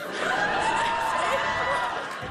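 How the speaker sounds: squealing burst of laughter; high laugh. Real, not acted. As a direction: laugh out loud, high and giddy. Reacting to grandma's secret.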